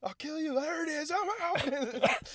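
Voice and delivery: very high, strained comic voice; squealed mock threat. Friend playing the threatening sergeant, high mock threat.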